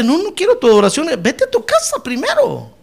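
lightly voiced Lord turning someone away; the Lord sending him home. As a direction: rise high and send him off firmly.

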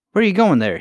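high, loud alarmed call; cried out. A narrator's reading. Gertie calling after Irene into the hole, loud cry. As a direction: shout after her as she vanishes. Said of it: "he cried".

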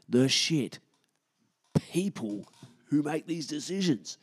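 rising frustrated rant; getting angry. Real, not acted. Vlogger ranting about town planning, getting angry. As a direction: let the anger build on each insult. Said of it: "I'm getting angry".

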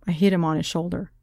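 ordinary delivery, nothing marked

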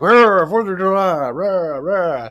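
hammed-up townsfolk chant; mock crowd cheer. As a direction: cheer like a mindless mob. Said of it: "They're all like".

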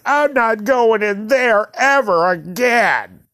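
high, drawn-out mock wail; crying kid. Kid to mom after the wave pool, crying wail. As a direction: imitate a bawling child. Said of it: "And I just start crying again".

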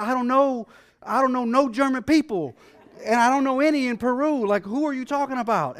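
high, loud frantic pleading; frantic panic. Retold or imitated. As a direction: blurt it out in a rush of panic. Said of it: "I'm frantic. I'm panicking".

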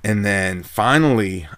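ordinary talk

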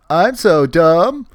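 high, loud, slow dopey voice; imitating a blissfully dumb cartoon character; mock dumb voice. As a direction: play it like a clueless goof. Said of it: "show how dumb he is".

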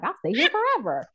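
very high, singsong mock devotion; hammed-up bribed employee; mock gushing. Mocking herself for staying on for raises, very high mock gush.

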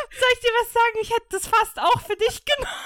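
high, loud, laughing. Real, not acted. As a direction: burst out laughing mid-sentence. Laughing at the lyrics.

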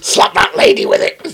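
high, loud hissed goblin order; mock goblin command. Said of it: "goblin voice by S1".

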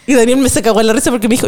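ordinary talk

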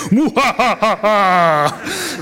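slow, drawn-out villain laugh; evil cackle. Retold or imitated. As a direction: cackle like a cartoon baddie. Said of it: "she's very good at the evil voice".

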